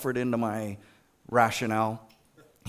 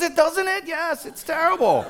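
very high, louder mock lament; exaggerated agreement; 5 dB louder than usual. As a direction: agree as if it were a tragedy. Acting out shared exaggerated outrage.

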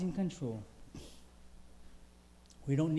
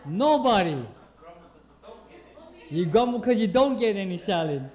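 much louder, teasing the crowd about salad; loud joking; 4 semitones higher, 10 dB louder than usual. Acted. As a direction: punch the joke out loud to the room.

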